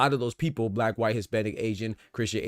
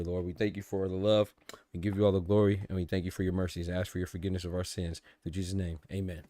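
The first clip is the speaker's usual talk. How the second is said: prayer; 3 semitones lower than usual. Acted. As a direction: pray it low and humble. Opening prayer to the Lord.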